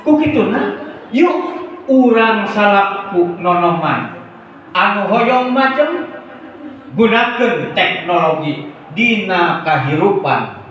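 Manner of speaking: high, deliberate youth-rally oratory; rousing speech. Shown as a demonstration. Speaker urging young people to use technology, rousing oratory. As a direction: open high and urge the audience on.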